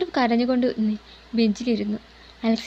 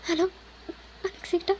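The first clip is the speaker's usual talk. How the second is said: small, higher, shaky-voiced call, much softer; a frightened girl's voice cracking on his name; trembling voice; 6 semitones higher, 7 dB quieter than usual. Mithra calls Alex in distress, voice faltering. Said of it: "ഇടറുന്ന ശബ്ദത്തോടെ അവൾ വിളിച്ചു".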